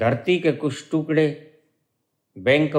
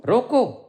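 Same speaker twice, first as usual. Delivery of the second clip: "रोको" alone, high, then stop; stop after रोको; 9 semitones higher, slower than usual. Shown as a demonstration. Teacher demonstrating where to halt, stop after रोको. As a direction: punch रोको high and halt before the next word. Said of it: "ठहरो".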